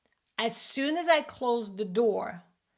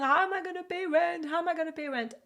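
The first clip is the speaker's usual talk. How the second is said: crying mom; 7 semitones higher, faster than usual. Retold or imitated. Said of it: "And she's crying".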